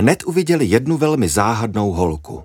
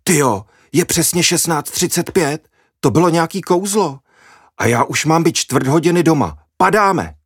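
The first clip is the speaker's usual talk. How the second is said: higher, rushed alarm at being late; blurted excitement; 8 semitones higher than usual. Acted. Julča blurts to her friends she is late, excited. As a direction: gasp it out, then urge everyone to run.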